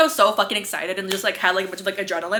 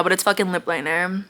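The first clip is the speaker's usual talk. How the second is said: normal talking voice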